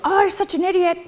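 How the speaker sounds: high, sneering inner-critic voice; a mocking inner voice jeering at a slip; harsh inner critic. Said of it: "your little voice goes".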